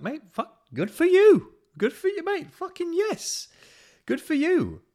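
very high, loud squeaky cheer; high-pitched, over-the-top hype for a friend; gushing cheer. Retold or imitated. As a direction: gush like an overexcited mate. Mate cheering his friend on.

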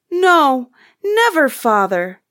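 high, loud protest stepping downward; emphatic cry. A narrator's reading. Children reassuring their father, crying out. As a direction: cry it out firmly from a high start. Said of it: "they all cried again".

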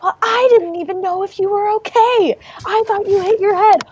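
high-pitched indignant shrieking; imitating a frantic childhood argument; mock screaming. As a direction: yell back like an upset kid. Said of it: "it's just screaming".

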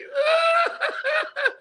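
high burst of laughter. Real, not acted. Host bursts into high laughter after mocking the quarterback. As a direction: crack up at your own bit.

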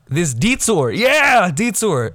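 high, loud eureka on yeah; relieved shout of yeah; triumphant. Real, not acted. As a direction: found it at last. Finally finding the word.

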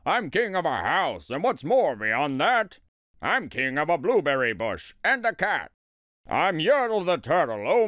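mildly grand turtle king; lightly proclaimed royal boast, relishing each claim; crying out boastfully. A narrator's reading. Yertle lists the things he now rules, boastful. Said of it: "Yertle cried".

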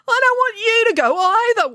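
high, loud, hammed-up wail. Binky clinging to his departing sister, wailing. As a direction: wail like an upset child. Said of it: "wailed Binky".

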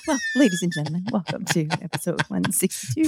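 soft, smooth cabin announcement; lightly imitating an airline welcome; flight attendant voice. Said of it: "You sound like a flight attendant".

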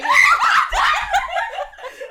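high shrieking laugh-scream. Real, not acted. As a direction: let out a shrill laughing scream. Screams after worm scare.

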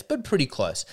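ordinary delivery

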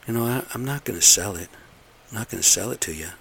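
usual speaking voice

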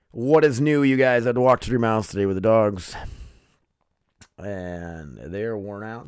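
normal talking voice

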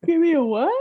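loud, high, baffled interjection; startled question. Real, not acted. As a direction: burst out in real confusion. Startled by unknown game name.

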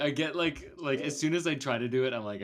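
ordinary delivery

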